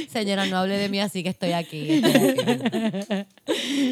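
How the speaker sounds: laughing. Retold or imitated. Voicing doctor, laughing.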